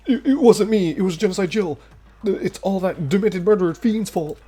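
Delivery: high, loud, frantic excuses; panicked denial. Acted. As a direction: blurt out the blame in panic. Character panicking, blaming another.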